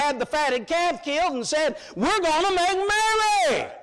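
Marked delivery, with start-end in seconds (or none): 2.0-3.7 father's high, loud shout of joy; joyful father. Acted. Father calling his household to celebrate, loud joyful shout. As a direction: shout it out like the father throwing a party.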